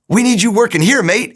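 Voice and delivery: mock Aussie exclamation, light and playful; Australian accent. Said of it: "he's an Australian guy".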